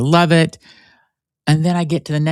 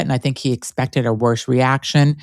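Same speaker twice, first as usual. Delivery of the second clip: ordinary talk, nothing marked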